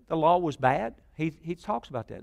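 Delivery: ordinary talk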